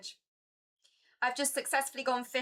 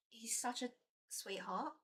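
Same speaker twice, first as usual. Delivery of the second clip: much softer, tender; soft coo; 11 dB quieter than usual. Real, not acted. Owner murmurs fondly about her cat, softly cooing.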